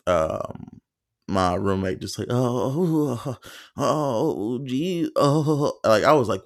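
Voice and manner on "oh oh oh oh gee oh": high imitated moans; mock moaning. Roommate heard in the dark: imitated high moans.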